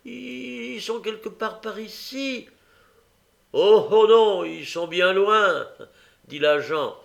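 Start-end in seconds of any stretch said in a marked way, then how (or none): 0.0-2.5 put-on high pleading child voice; plead, pointing the officer away; the boy begging the policeman; desperate child. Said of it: "avec désespoir". 3.5-5.7 loud, high mocking jeer. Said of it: "croyant se moquer".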